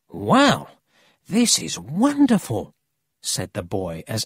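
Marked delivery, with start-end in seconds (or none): 0.1-2.7 loud wow, then higher delight; thrilled. Acted. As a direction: marvel like an excited boy. Boy thrilled by Emil's story.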